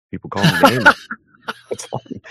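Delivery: laughing through the words, ending in a voiceless breath. Real, not acted. Veteran laughing off name-calling, then a voiceless breath. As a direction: shrug off the insult with a laugh. Laughs off being called names.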